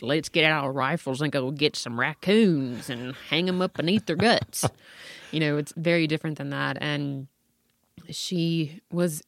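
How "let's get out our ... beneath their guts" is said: hammed-up Tennessee twang; twangy Southern accent. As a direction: lay on a thick rural Southern twang. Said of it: "my very twangy".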